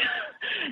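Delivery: short, high nervous laugh; a quick disbelieving laugh. Real, not acted. Laughing off a death warning from the therapist, nervous laugh. Laugh after hearing the threat.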